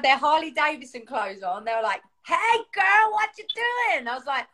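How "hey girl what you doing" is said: high, drawn-out hollered greeting; high-pitched, whooping biker impression; excitable biker couple. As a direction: imitate an overexcited biker greeting a girl.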